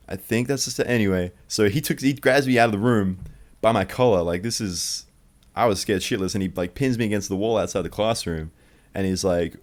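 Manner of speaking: ordinary delivery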